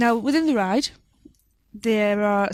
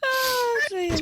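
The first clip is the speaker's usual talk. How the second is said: laughing; 12 semitones higher than usual. Real, not acted. Laughing at silly joke.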